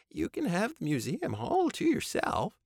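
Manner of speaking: high, timid offer; Babe voice. A narrator's reading. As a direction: coax it sweetly.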